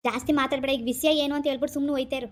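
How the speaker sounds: low, stern order; angry dismissal. Acted. Brother cutting short his pleading sister, angry. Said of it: "ಕೋಪ ಮಾಡ್ಕೋಬೇಡ".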